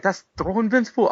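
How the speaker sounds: high incredulous question; mock-stunned, as if he can't believe it; imitated surprise. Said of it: "He was like".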